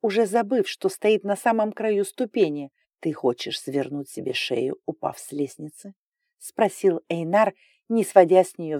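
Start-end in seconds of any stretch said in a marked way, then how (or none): 3.0-5.9 menacing dragon voice. A narrator's reading. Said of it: "В его голосе звучала угроза".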